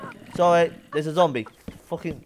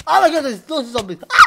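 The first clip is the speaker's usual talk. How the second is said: panicked shout; 11 semitones higher, 10 dB louder than usual. Real, not acted. Jump scare by zombies.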